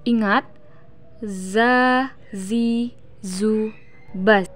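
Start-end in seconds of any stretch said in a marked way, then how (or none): 1.2-4.5 "Za, zi, zu, baz" with buzzing z; wasp-like buzz. Shown as a demonstration. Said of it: "mirip dengan suara dengungan tawon".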